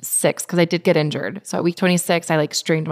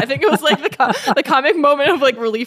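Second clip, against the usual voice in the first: real laughter breaking through the words; amused, laugh-filled recollection; laughing while talking; 6 semitones higher, 4 dB louder than usual. Real, not acted. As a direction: talk through the giggles, higher and louder. Laughing over remembered joke.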